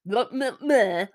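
dismissive mocking. Mocking a cast member's answer.

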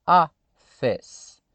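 "office" as two syllables, an open a sound first, no final i; English pronunciation. Shown as a demonstration. Teacher to learners, stressing the two-syllable "office". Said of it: "Ela tem duas sílabas".

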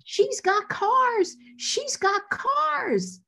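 high, slow, stretched-out mock yell. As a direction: holler the big news across the set. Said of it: "and he yells".

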